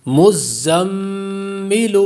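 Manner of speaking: مزمل recited very slowly with a held nasal ghunna on the doubled meem; tajweed recitation. Shown as a demonstration. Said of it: "میم مشدد ہے، غنہ ہوگا".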